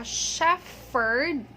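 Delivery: "shepherd" drawn out slowly as SHEF-ferd; mispronounced shepherd. Retold or imitated. Teacher imitating what people say, F mispronunciation. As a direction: stretch an F in the middle of "shepherd". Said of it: "So they say".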